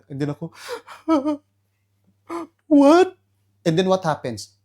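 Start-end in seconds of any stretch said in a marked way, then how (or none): 0.6-1.4 high startled gasp; mock gasp. The husband hearing his wife's problem, a high startled gasp. 2.7-3.2 much higher, much louder alarmed what; mock shocked what. The husband reacting to his wife's problem with a loud shocked what.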